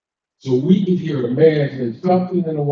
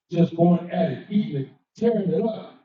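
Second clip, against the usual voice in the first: ordinary talk, nothing marked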